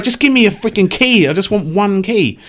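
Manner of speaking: high-pitched mock-desperate begging; exasperated plea. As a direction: plead in comic frustration. Retelling own frustration at dice.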